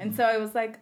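normal talking voice

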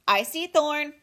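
high, loud urgent robot call; shouted. A narrator's reading. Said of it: "shouted Roz".

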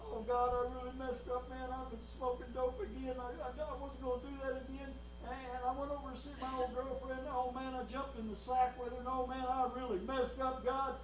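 confessing sinner. Retold or imitated. Preacher voicing a backslider confessing at the altar, hushed plea. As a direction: murmur it like a guilty man at the altar. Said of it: "some guy, you know".

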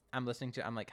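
ordinary talk, nothing marked

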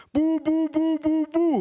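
very high monotone falsetto; aunt's squeaky, insistent cooing; threatening baby babble. A narrator's reading. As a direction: push nonsense syllables at a baby. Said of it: "rief sie drohend".

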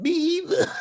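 very high squeal breaking into laughter; laughing. Retold or imitated. Husband admitting it to his wife, laughing squeal. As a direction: crack up on his own punchline.

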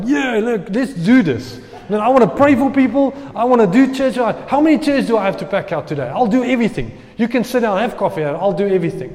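bursting with eager energy to serve; pumped up. Retold or imitated. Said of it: "I was like".

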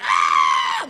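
long, loud mock crowd scream; imitated crowd screaming. Imitating fans losing it over the singer, loud scream. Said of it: "everyone's just like".

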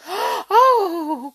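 gasp, then cry out loudly in fright; frightened cry. A narrator's reading. Brownies fleeing the king, in great fright. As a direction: gasp and high wailing cry. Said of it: "cried the three brownies in a great fright".

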